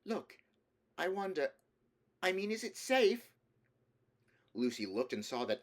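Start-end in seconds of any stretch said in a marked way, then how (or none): 0.0-3.3 high, hesitant worried voice; nervous girl. A narrator's reading. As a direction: ask with growing unease. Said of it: "said Susan in a different tone".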